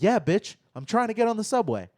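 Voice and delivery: 3 toss it back at the cop, annoyed; 1 exasperated retort. Retold or imitated. 4 telling the police he just wants the subway, exasperated retort. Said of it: "And I'm like".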